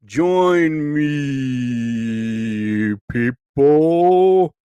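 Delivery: drawn-out stretched words in a strange voice; a strange drawn-out live-stream voice; odd put-on voice. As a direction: stretch each word like a weird broadcast. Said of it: "Why am I talking like this?".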